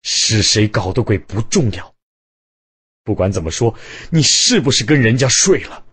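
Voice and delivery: husband shouting at his unfaithful wife; roaring. A narrator's reading. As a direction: bellow the accusation. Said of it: "卞江大声吼道".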